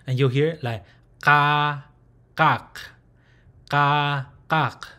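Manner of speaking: "ka" and "kak" with a slight glottal catch; glottal stop ka. Shown as a demonstration. Teacher showing the glottal stop in "ka, kak". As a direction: catch the throat lightly before "ka". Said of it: "There is slightly a, a glottal stop".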